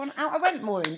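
usual speaking voice

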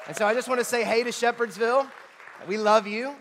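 loud, high, fast greeting; excited shout-out. Real, not acted. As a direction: call out to the other campus with joy. Greeting the other campus.

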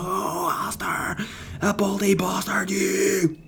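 slow, high, wailing put-on voice; mock fan. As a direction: howl it like an overjoyed voter. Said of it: "you're not gonna".

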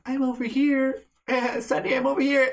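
high, teasing little call at normal volume; playful hiding girl. Acted. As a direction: call out sweetly as if in hide-and-seek. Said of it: "It starts out and it's a game".

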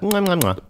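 high, hammed-up smacking kisses; mock-villain kiss sounds; blown kisses. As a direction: blow kisses like a cheerful villain. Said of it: "Are you blowing kisses while you laugh like a villain?".

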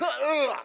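loud retching groan of revulsion; hammed-up "ugh"; disgusted ugh. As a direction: groan as if about to gag. Revulsion at remembered pancake.

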